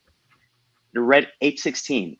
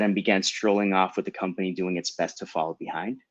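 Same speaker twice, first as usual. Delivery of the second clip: ordinary delivery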